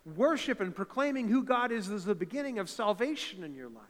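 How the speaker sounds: ordinary talk, nothing marked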